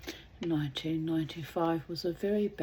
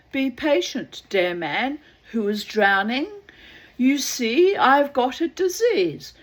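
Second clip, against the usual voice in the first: calling out; 5 semitones higher, 9 dB louder than usual. Acted. Man with disease replies.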